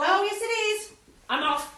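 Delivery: loud, high, triumphant; gleeful defiance. Acted. Rival rushes off loudly to win the race. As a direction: shout it as you dash off. Racing off to coach.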